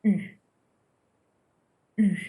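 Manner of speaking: "U" said as French u, tongue flat, lips in a tight circle; French u sound. Shown as a demonstration. Teacher modelling French "U" for students, lips rounded. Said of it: "same sound as its letter".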